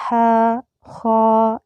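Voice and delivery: ہا and خا said slowly and deliberately; slow letter names. Shown as a demonstration. Teacher modelling two-letter names ہا and خا. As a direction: spell out ہا, خا carefully. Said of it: "اسے ہم زیادہ لمبا نہیں کریں گے".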